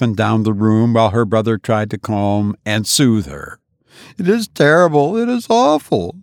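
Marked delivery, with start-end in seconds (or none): 4.2-6.2 distraught cry. A narrator's reading. Wife to brother, anguished high cry. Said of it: "she cried".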